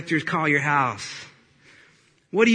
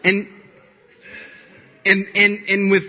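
normal talking voice

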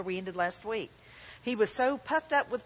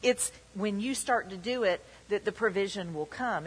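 ordinary talk

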